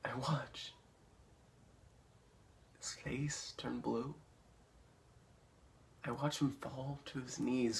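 soft, near-whispered menace; chillingly hushed. Acted. Paul watching Chris choke in his dream, hushed. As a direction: let it drop to a hush.

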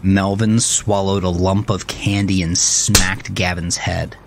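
usual speaking voice

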